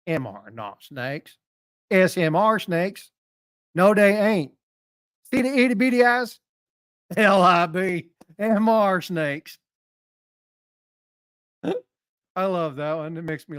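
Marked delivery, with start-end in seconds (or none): 0.1-1.4 slow, low Southern drawl on "M R Not Snakes", said like "Mister Not Snakes". Shown as a demonstration. Streamer speaking the meme for chat in a Southern drawl. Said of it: "you have to kinda talk with the Southern accent". 1.9-9.6 louder, slow, hammed-up Southern accent, stretching the words; hammed-up Southern drawl. Shown as a demonstration. Streamer performing the meme for chat, loud Southern drawl. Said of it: "you have to kinda talk with the Southern accent".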